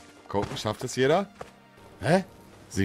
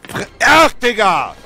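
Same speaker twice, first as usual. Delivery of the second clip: berserk rage; 9 semitones higher, 7 dB louder than usual. Real, not acted. Rage at failure.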